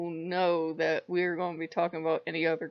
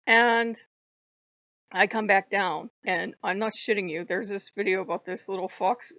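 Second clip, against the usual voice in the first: ordinary talk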